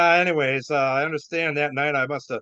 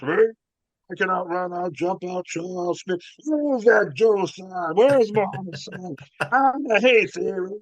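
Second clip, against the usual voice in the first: imitating a drunken braggart, slow and higher; bellicose boasting; 4 semitones higher, slower than usual. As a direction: carry on like a belligerent idiot. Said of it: "very bellicose".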